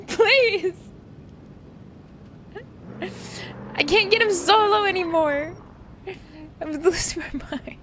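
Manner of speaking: high-pitched, wailing plea; distraught high whine, easing off at the end; desperate pleading. Real, not acted. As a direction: plead as if losing your mind. Overwhelmed, pleading, losing her mind.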